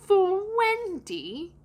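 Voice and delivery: high, stretched-out disbelieving question; put-on boy, scandalised and very slow; aghast. A narrator's reading. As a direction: swoop down and up again in horror. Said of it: "John said aghast".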